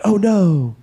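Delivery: loud, high dismay. Retold or imitated. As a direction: cry out in sudden dismay. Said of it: "you're like".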